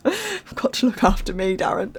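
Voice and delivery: laughing while talking. Real, not acted. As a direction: giggle through the words. Laughing aside about alarm.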